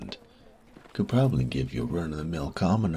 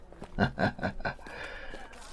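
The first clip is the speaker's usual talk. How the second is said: soft laugh; 5 semitones higher, 3 dB quieter than usual. Acted. Relishing memories of the circus.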